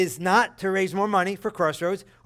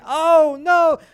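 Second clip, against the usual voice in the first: very high, much louder panicked cry; daughter freaking out; 10 semitones higher, 10 dB louder than usual. Retold or imitated. Daughter at the restaurant, high loud panicked cry. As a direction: wail it like a frightened little girl. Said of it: "she's freaking out, screaming".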